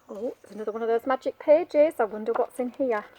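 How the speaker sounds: ordinary delivery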